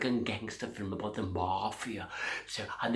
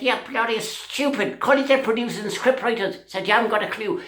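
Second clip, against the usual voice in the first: high, loud, indignant rant; comic high-pitched fury; mock outrage; 9 semitones higher, 8 dB louder than usual. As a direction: storm out cursing the lot of them. [name] crashes out of audition.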